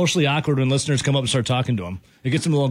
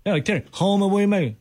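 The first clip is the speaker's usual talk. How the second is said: put-on Aussie accent; 6 semitones higher than usual. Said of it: "What's wrong with my accent?".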